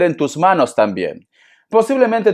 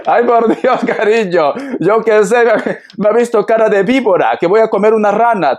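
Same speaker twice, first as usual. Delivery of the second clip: amused protest; 6 semitones higher than usual. Real, not acted.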